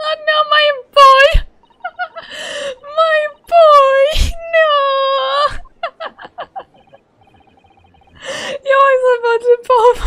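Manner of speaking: high, loud squealing wail of dismay; laughing wail. Real, not acted. Dismayed outburst over a game character.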